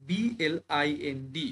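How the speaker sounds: drawn-out letters for students; teacher spelling বিএলআইএনডি slowly. Shown as a demonstration. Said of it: "দেখো".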